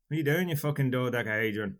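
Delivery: Scottish accent, thrown out as an insult. Shown as a demonstration. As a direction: use a Scottish accent to turn it into abuse. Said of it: "in a Scottish accent".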